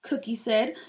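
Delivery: usual speaking voice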